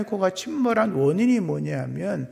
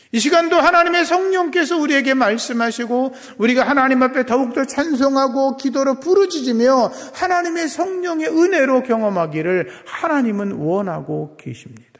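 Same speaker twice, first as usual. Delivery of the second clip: raised, higher preaching voice; impassioned preaching; 8 semitones higher, 6 dB louder than usual. Real, not acted. Pastor urging believers to pray boldly, impassioned preaching. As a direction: drive it out loud to the congregation.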